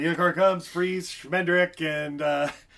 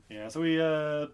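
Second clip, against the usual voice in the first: ordinary delivery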